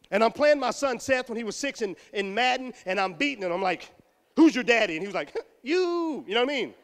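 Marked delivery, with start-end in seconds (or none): 4.4-4.9 loud, boastful "Who's your daddy?"; gloating taunt. Retold or imitated. Taunting his young son. 5.6-6.2 put-on higher, small-boy "You."; little son's answer. Six-year-old son answering meekly.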